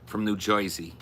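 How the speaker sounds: put-on Jersey accent; hammed-up New Jersey speech. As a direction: talk like a Jersey local. Imitating New Jersey locals' talk.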